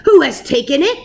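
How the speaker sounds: loud angry roar; a furious husband roaring. A narrator's reading. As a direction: bellow it at the whole house. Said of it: "he roared".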